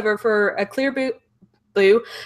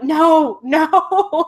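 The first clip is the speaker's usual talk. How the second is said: higher, drawn-out mock "no"; dismayed protest; 7 semitones higher than usual. Replying to compliments on an unwanted accent, dismayed protest.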